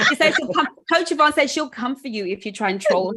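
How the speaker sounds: high, loud giggly teasing; laughing, teasing. Real, not acted. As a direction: tease the trolls through laughter. Joking threat about Coach Yvonne.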